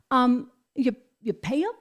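ordinary talk

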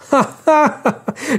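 high acted tipsy laugh; burst of laughter. A narrator's reading. Tipsy marquis laughing at the girl, high acted laugh. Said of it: "scoppiò a ridere, reso gaio dal vino".

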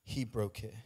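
preacher spent and breathless after shouting; out of breath. Real, not acted. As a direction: let the breath run out on the words. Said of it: "I'm out of breath".

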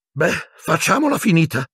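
high-pitched official's voice; shrill committee member. A narrator's reading. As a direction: put on a high, piping voice, impatient to finish. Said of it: "disse la voce acuta del membro del comitato". (voce acuta)

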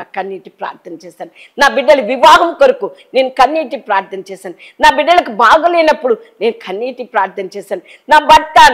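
ordinary delivery

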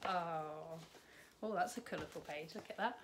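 ordinary delivery

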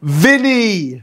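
high, drawn-out playful exclamation; mock dramatic reveal. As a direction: reveal the name with a big gasp beforehand. Dramatic reveal of date's name in game.

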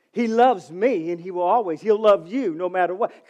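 normal talking voice

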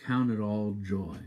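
usual speaking voice

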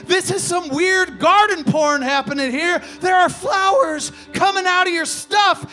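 high, loud, panicked shout; loud, panicked shouted protest; bewildered outburst. Acted. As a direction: yell in disbelief.

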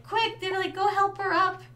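high-pitched imitation of alarmed bystanders; retold urgent onlookers. Bystanders rushing to help her up, mock urgency.

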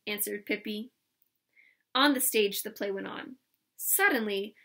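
ordinary delivery, nothing marked

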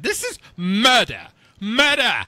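high, emphatic cries of murder easing into stiff formality; hammed-up outraged official; mock outrage. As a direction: declare it a crime with theatrical indignation. Mock outrage at squirrel news.

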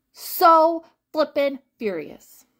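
slow, loud exasperated outburst; furious. Real, not acted. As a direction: drag out each word in mock rage. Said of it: "So flippin' furious".

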